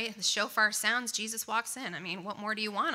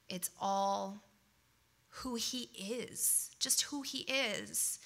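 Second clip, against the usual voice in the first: reverent; much slower than usual. Real, not acted. Reverent declaration of God.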